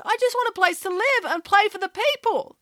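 put-on high, sincere young pig; a pig explaining what she wants; earnest pig voice. A narrator's reading. Gwyneth voiced.

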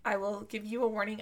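ordinary talk

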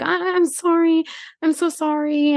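high, slow mock apology; hammed-up girly whimper; mock whiny girl. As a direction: mimic a simpering drunk girl. Mimicking drunk girl in TikTok.

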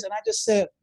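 normal talking voice

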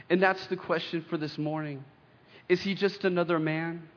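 ordinary delivery

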